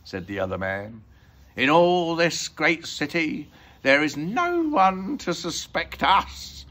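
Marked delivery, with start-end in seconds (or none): 1.5-6.6 slow, loud, high villain voice; loud, drawling robber; gloating thief. A narrator's reading. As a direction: stretch it out like a smug storybook crook. Crook gloats to his partner.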